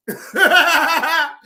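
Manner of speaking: high, loud burst of laughter. Real, not acted. Streamer retelling the insult, loud laugh. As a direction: let out a big cackle. Cracking up at being cursed out.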